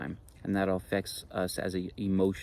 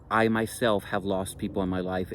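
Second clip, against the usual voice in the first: normal talking voice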